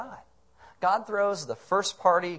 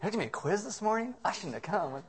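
grumbling listener; 4 semitones higher, 5 dB quieter than usual. Acted. Voicing reluctant listeners' thoughts.

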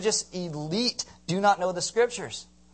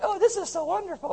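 high-pitched gushing imitation; mock delight; 12 semitones higher than usual. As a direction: parody someone who is always happy. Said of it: "people are always happy".

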